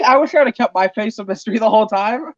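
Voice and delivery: normal talking voice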